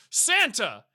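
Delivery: high, loud mock call; villain's shout. Jack Frost calling to Santa, hammed-up shout. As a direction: shout the name out like a film villain. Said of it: "And he goes".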